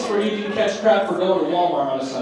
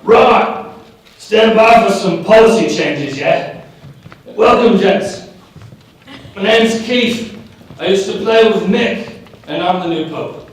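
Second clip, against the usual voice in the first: louder lightly voiced British rocker; 4 semitones higher, 4 dB louder than usual. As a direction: play a laid-back rock star addressing the press. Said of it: "[name] at the Pope walks out there and goes".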